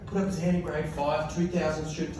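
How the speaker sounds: ordinary talk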